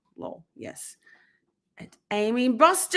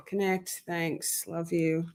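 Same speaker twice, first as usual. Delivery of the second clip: usual speaking voice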